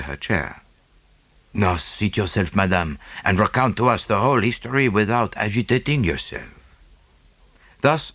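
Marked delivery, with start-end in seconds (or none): 1.5-6.5 gentle Poirot. Acted. Poirot voiced.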